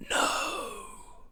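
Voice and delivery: breathless, distant whisper like wind in trees; windy whisper. A narrator's reading. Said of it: "like the sound of wind in distant trees".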